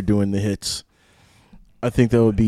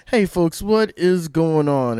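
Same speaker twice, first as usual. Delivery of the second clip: announcer voice; 5 semitones higher, slower than usual. Acted. Second show greeting.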